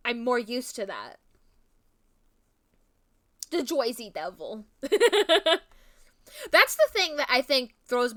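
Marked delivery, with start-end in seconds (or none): 3.5-4.7 put-on Jersey accent. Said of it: "classically, like, Jersey side of Jersey".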